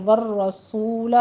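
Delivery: slow والرسول with a heavy rolled ra; tajweed demo. Shown as a demonstration. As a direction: roll the ra of والرسول twice, heavy. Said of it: "را مفخم پڑھی جائے گی".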